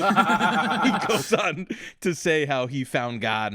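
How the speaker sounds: words squeezed through laughter; laughing while talking. Real, not acted.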